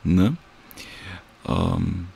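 normal talking voice

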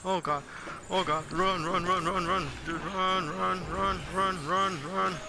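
panicked high yelling run, run; frantic, fleeing for your life; being chased. Real, not acted.

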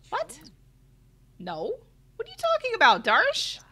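high, loud disbelief; incredulous. Real, not acted. Streamer startled that Darsh woke up. Sim unexpectedly awake.

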